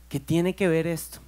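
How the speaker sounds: ordinary talk, nothing marked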